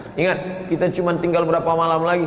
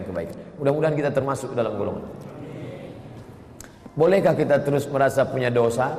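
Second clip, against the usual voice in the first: usual speaking voice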